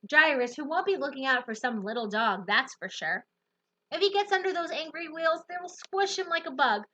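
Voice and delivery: usual speaking voice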